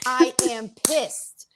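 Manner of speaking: high, slow, drawn-out mock indignation; acted pissed-off whine. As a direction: stretch out each word in comic exasperation. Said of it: "but I'm pissed".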